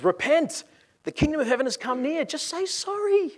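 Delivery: high-pitched street-corner call, rising at the end. Acted. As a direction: cry it out to passers-by. Street preacher John calling out to passers-by.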